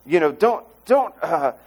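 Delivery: mock-grovel like a cornered demon; a light hint of terrified demons pleading. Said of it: "When demons come and say".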